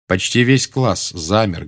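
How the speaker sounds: plain reading voice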